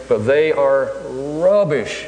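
"rubbish" leaned on hard, the whole line slowed right down; emphasis on rubbish. Retold or imitated. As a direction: drag out "but they are rubbish" and land hard on "rubbish". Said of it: "real emphasis on that word rubbish".

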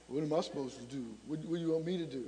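low, soft mock grumble; typical male response. Son responding to his mother's hint, low reluctant mumble. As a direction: mutter it low, like a put-upon man. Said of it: "Almost a typical male response".

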